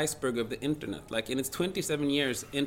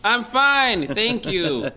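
loud put-on announcer voice; mock radio voice; 7 semitones higher, 9 dB louder, much slower than usual. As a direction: answer like an overblown radio host. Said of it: "Do we have to talk like this".

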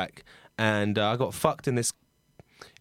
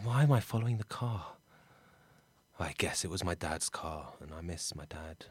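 quiet, low musing to himself; subdued soliloquy with long pauses; weary lone monologue; 4 semitones lower, 4 dB quieter than usual. Acted. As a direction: think aloud softly, letting the ends sink away. Character talks to himself, alone.

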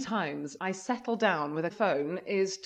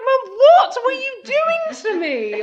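high, loud mock wail at mum; mock outraged child; 13 semitones higher, 9 dB louder, slower than usual. Child complaining to mum about the outfit, high loud mock wail. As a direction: whine at mum like a wronged kid.

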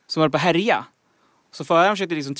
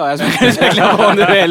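higher, much louder, through real laughter; laughing; 5 semitones higher, 11 dB louder than usual. Real, not acted. Host offers the stumped contestant more, laughing.

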